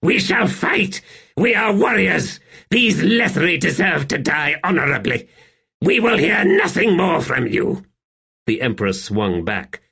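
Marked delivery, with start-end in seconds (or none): 0.0-7.8 much higher, louder, slowed at first; frenzied ranting. A narrator's reading. Rulad silencing Hanan Mossag, frenzied high ranting. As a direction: rage at his adviser in a frenzy. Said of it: "he shrieked".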